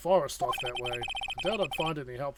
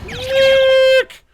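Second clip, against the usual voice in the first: shouting; 17 dB louder than usual. Acted. Yelling at sound camera.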